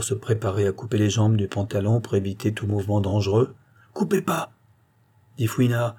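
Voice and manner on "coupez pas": sudden objection. A narrator's reading. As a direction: pipe up to stop the doctor. Injured man suddenly objects to cutting. (protestation soudaine, voix haut perchée)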